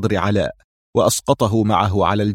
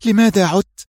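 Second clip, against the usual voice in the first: breathless exclamation; 10 semitones higher, 3 dB louder than usual. A narrator's reading. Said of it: "هتفت به لاهثة".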